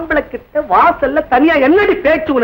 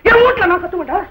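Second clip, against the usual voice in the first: raised, higher-pitched defiance; defiant yelling; 8 semitones higher than usual. Acted. Man defending his yelling in his own house, defiant yelling. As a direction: insist on the right to yell. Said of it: "ஏய், கத்துறேன்னு பேசாத".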